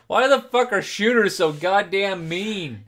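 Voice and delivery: loud, high, drawn-out exasperated complaint; exasperated outburst. Real, not acted. Player complaining to partner about the shots, loud exasperation. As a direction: cry out in protest, slow and loud.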